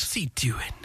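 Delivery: whispered aside. Real, not acted. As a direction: say it in a hushed breath.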